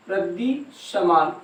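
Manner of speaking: soft, drawn-out रद्दी समान for note-takers; slow dictation. Shown as a demonstration. Repeats phrase slowly for dictation.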